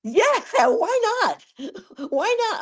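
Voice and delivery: very high, giggling reply; giggly. Real, not acted. Singer asked about the song's meaning, giggly. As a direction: squeal it through a laugh. Amused answer to cheeky question.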